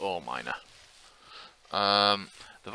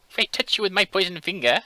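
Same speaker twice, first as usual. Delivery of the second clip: high, loud bleating goat threat; 12 semitones higher, 9 dB louder than usual. Acted. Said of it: "that's my goat impression".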